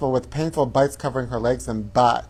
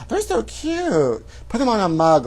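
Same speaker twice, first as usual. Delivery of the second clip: cutesy gushing; 6 semitones higher than usual. Acted. Host fawns over the playful otters, cutesy gushing.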